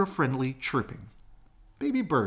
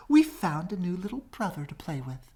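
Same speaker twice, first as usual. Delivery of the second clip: higher, happy news; excited young swans; 9 semitones higher than usual. Acted. Young swans telling mother about new brother, high and eager. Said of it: "his new friends cried".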